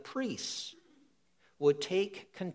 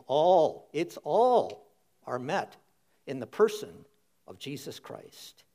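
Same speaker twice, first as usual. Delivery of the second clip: louder, punching ALL; said again, emphatic; 3 semitones lower, 5 dB louder than usual. Real, not acted. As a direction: hit "All" hard and proclaim it. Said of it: "Say it again".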